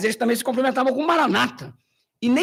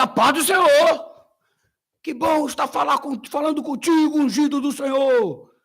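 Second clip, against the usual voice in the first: hammed-up pentecostal greeting; 5 semitones higher, 3 dB louder than usual. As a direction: play the fervent pentecostal right back. Mimicking pentecostal greeting back.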